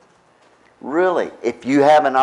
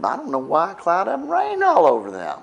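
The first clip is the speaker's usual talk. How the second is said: rueful laugh; 5 semitones higher, faster than usual. Retold or imitated. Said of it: "went home and said".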